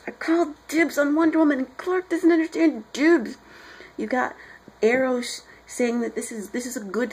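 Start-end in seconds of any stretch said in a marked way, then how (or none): 0.1-3.4 high-pitched, emphatic griping; mock indignant complaint. Hal Jordan griping about his dibs on Wonder Woman, mock indignant. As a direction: complain like you've been cheated.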